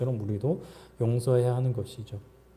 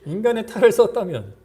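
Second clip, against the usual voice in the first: high, louder incredulous complaint; venting to God; 6 semitones higher, 6 dB louder than usual. Acted. Believer pouring out complaints to God about someone, indignant. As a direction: protest in rising disbelief, pitched up. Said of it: "라고 그냥 다 얘기하십시오".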